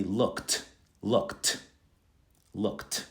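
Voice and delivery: LOOKED as one syllable ending k-t. Shown as a demonstration. Teacher modelling how to pronounce it, t ending. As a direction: repeat LOOKED with a crisp t each time. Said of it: "To pronounce it".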